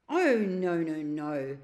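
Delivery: shocked protest, loud on the first oh no. Acted. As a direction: recoil at the very idea. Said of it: "in a shocked voice".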